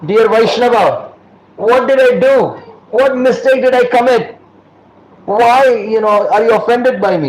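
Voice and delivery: high, earnest plea, acted out; anxious pleading. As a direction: plead to know your mistake. Acts Rupa pleading with offended devotee.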